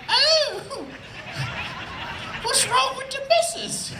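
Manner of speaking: very high-pitched British put-on voice; annoying falsetto British character; silly British voice. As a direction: pester your wife in a squeaky British voice. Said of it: "Stop doing the voice".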